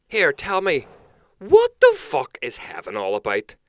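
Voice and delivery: put-on loud, high drunk bloke demanding an answer; drunk punter. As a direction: belt it out like a pint-sodden regular. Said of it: "your man's just like".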